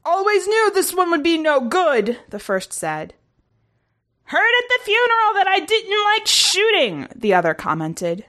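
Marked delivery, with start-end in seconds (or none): 0.0-2.2 high, altered alien voice; grumbling crew member. A narrator's reading. Crew member disparaging dead comrade, grumbling. 4.2-7.1 very high, altered alien voice; gossiping crew member. A narrator's reading. Crew member chatting over corpse, gossiping.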